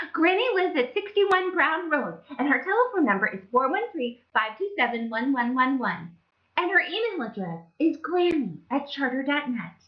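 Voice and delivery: put-on high, fast little-girl voice; high childish rattle; chatty Little Red. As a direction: rattle off the address innocently. Little Red blurts details.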